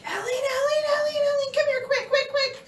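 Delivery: high, loud, urgent calling; frantic little brother. Retold or imitated. Brother summoning sister to the phone, frantic cry. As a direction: imitate a kid calling in a panic.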